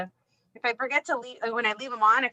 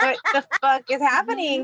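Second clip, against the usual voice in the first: higher, baffled cry; confused outrage; 4 semitones higher, 3 dB louder than usual. Retold or imitated. Can't turn on the kitchen light, baffled. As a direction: sound lost in the dark kitchen. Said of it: "And I'm like".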